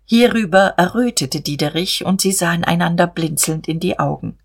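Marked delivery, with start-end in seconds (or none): none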